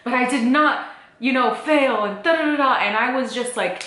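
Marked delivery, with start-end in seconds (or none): 0.0-2.7 mock TikTok voice. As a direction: parody an upbeat comeback video. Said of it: "those TikTok videos that are like".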